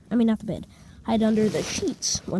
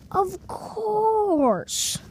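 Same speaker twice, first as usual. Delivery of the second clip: high, long-drawn exclamation sliding down; dramatic realization; 10 semitones higher than usual. Acted. Storyteller realizing VidCon is today, hammed-up revelation. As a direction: stretch it out high in mock revelation.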